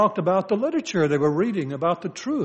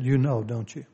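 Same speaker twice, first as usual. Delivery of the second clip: softer, low and gentle; gentle question; 4 semitones lower, 5 dB quieter than usual. Shown as a demonstration. Pastor shows how she really asked it, softly. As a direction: ask it softly, almost tenderly. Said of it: "she didn't say it in that manner".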